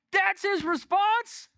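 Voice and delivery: high, drawn-out disbelief; incredulous. Acted. Preacher reacting to the man's answer, incredulous. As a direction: balk at it in a high, slow voice.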